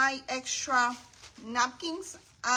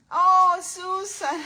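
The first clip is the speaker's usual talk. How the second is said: much louder, higher and slow; delighted greeting; 6 semitones higher, 11 dB louder, slower than usual. Real, not acted. Spotting a friend's comment in the live chat, delighted and loud. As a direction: call out the name with a happy burst.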